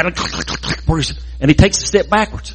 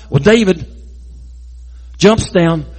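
ordinary delivery

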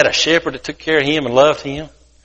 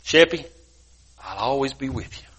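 lightly voiced shepherd, softer and lower at the end; gentle shepherd; 4 semitones lower, 7 dB quieter than usual. Shepherd promising Sheppy he'll stay, gently reassuring. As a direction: reassure softly, a touch lower. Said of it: "The shepherd told Sheppy".

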